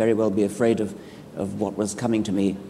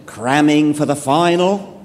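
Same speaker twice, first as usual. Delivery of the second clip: slow, higher Australian drawl; Australian accent; 5 semitones higher, 5 dB louder than usual. Retold or imitated. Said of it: "I try to do the Australian accent".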